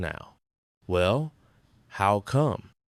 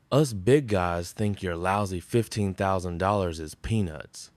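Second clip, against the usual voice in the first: ordinary delivery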